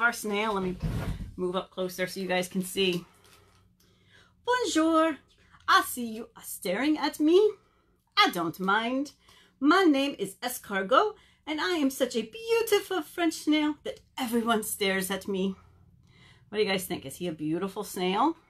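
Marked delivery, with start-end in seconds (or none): 4.4-15.5 silly, hammed-up French accent; terrible French accent. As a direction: vain snail in a bad French accent. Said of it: "do a really terrible French accent".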